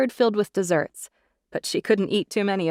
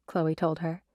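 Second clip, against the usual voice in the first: ordinary delivery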